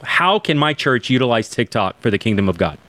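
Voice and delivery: ordinary delivery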